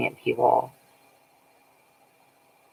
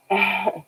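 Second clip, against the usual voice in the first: real laugh; 9 semitones higher than usual. Real, not acted. Laughing on stream.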